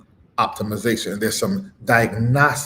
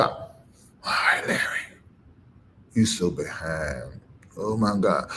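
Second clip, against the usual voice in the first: low, soft aside to himself; muttered self-reproach; 9 semitones lower, 7 dB quieter than usual. Real, not acted. Preacher chiding himself for being behind, muttered low. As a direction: drop low and mutter it to yourself.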